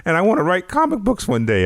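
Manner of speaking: put-on high squeaky teen voice. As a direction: squeak it out like a nervous kid. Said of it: "had the squeaky voice like this".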